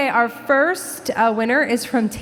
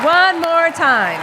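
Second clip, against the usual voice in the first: louder, slower call to the room; rallying the honorees with a slow loud call; emphatic call; 6 semitones higher, 5 dB louder, slower than usual. Real, not acted. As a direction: push the crowd to rise again. Urging audience to stand again.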